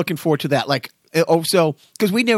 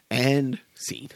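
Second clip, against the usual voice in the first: whisper; 4 semitones lower, 6 dB quieter than usual. Real, not acted. Wrapping up the bit.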